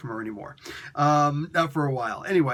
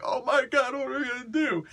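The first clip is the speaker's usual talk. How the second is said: much higher, panicked robot voice; panicked medic; 11 semitones higher than usual. Retold or imitated. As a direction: squeal it out in a comic panic. Ratchet panics at wrecked Autobots.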